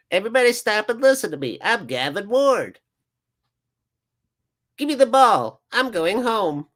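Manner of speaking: high, mocking referee voice; Gavin Ward. Fan mocks referee ordering everyone about, high silly voice. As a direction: put on a silly, high-pitched bossy ref. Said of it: "that's the voice I hear when I look at his face".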